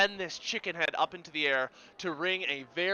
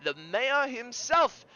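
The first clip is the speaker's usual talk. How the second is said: raised-pitch, theatrical carny send-off; carnival barker; 5 semitones higher than usual. Acted. Carny handing over a ticket to the mayor, hearty barker voice. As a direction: send the winner off with showman flourish.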